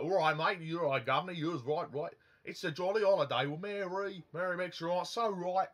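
over-the-top fake Cockney from Mary Poppins; bad Cockney imitation. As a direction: ham up a wobbly Cockney accent. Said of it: "it kind of dips into Australian".

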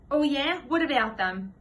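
a touch of schoolboy challenge; lightly voiced defensive comeback; challenging retort. A narrator's reading. Nicky challenges Andrew in story.

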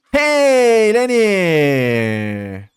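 loud, slow, long-drawn call; hollering a friend's name, stretched out. Real, not acted. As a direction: call across the camp to Lenny. Calling out to Lenny.

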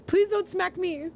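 normal talking voice

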